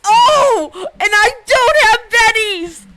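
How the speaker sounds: loud, high-pitched cry; hammed-up howl of despair; mock-despairing wail. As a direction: wail it out at the top of your voice. Player's outburst at failed check.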